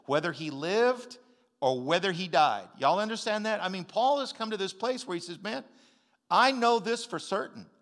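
loud, rising preacher's declamation building in intensity; full-force preaching. Real, not acted. Preacher to his congregation, preaching at full force. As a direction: proclaim it to the whole congregation. Preaching scripture and Paul's conviction.

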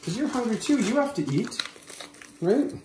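sing-song voice for the dog; high pet-talk voice. Real, not acted. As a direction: coax him like a puppy.

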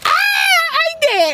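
stretched high yelp of surprise; high startled cry. Real, not acted. Sudden high exclamation.

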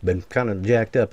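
ordinary delivery

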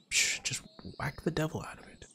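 soft unvoiced whisper; whispered aside. Gamer whispering advice to himself about the pig. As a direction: hiss it under your breath.

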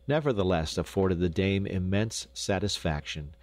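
plain reading voice

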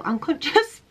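catching her own slip with a high laugh; mock-shocked at the wrong word "Unconscious?"; "Unconscious?" said high and questioning as a laughing double take; amused self-correction. Real, not acted. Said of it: "Conscious about yourself".

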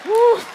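high woo; cheer. Real, not acted. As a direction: whoop for them.

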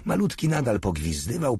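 ordinary delivery

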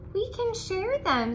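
friendly ladybug offering to share, high voice; friendly suggestion. A narrator's reading. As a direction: pitch it up sweetly to offer. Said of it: "suggested the friendly ladybug".

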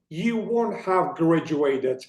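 slow, word-by-word textbook reading; careful reading. Shown as a demonstration. Teacher reading for Turkish learners, slow and deliberate. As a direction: give every word of "You won't have graduated" its full weight. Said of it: "önce İngilizcelerini okuyorum".

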